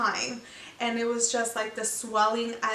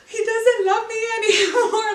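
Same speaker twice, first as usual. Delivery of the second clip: loud, high laugh-crying; mock sobbing wail; 10 semitones higher, 8 dB louder than usual. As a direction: wail it like a heartbroken dream. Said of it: "that I was just like".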